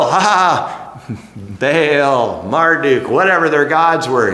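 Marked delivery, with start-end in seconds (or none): 0.0-1.1 mock laugh. Babylonians laughing at God.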